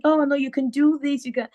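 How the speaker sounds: high, coaxing imitation of dad; insistent encouragement. Dad pushing daughter towards law, encouraging. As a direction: urge her on as dad.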